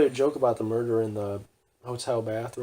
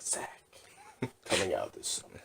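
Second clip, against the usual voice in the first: low, hushed trailer announcer; movie trailer voice; 4 semitones lower, 10 dB quieter than usual. Acted. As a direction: tease the film in a soft, deep murmur. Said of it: "it's like a commercial".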